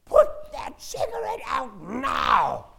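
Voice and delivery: high, slow, piercing shout at a stranger; a small boy shrieking an order, full volume; little son screaming at a smoking man; child's scream. Retold or imitated. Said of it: "he looks up and he screams".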